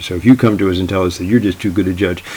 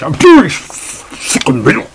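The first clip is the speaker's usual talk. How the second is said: louder, higher, slow hammed-up German whine; German accent; 7 semitones higher, 6 dB louder, slower than usual. Judge complains while stuffing burritos, loud slow mock German accent. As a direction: overplay a German accent, slowly. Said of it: "in a very strong German accent".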